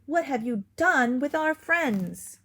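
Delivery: loud alarmed demand; alarmed dog confronting wolf; gasping. A narrator's reading. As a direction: demand it, shocked. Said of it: "she gasped".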